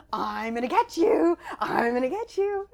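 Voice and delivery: loud teasing chase call; mock-menacing parent chasing a toddler; playful chase.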